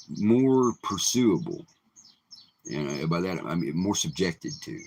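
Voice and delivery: usual speaking voice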